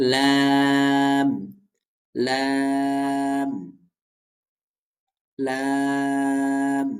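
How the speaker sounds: লাম intoned slowly, vowel and final m held long; touch tongue tip to upper gums and stretch out লাম; drawn-out model লাম for students to copy; demonstrated letter Lam. Shown as a demonstration. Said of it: "আমরা একটু উচ্চারণ যদি করে দেখি".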